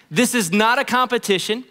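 usual speaking voice

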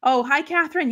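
ordinary delivery

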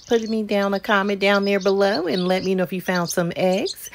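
ordinary talk, nothing marked

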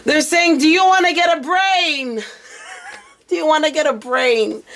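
high voice squeezed by laughter; cracking up. Real, not acted. Reader relaying spirit's rude question, high and laughing. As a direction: relay it while trying not to laugh.

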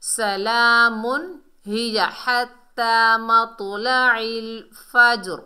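loud, slow chant with drawn-out vowels; Quran recitation. Shown as a demonstration. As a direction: recite the verse firmly and deliberately. Recites verse being taught.

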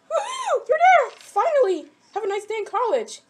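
mock cheering. Telling the kid about college, mock triumphant cheer. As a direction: cheer like you just made it into college.

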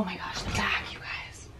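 hushed conspiratorial whisper; secret-sharing whisper; whispered aside. Real, not acted. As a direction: lean in and whisper to the viewers.

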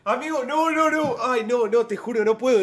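real disbelief, can't stand watching; pained loud protest; exasperated outcry. Real, not acted. Reactor exasperated by the video.

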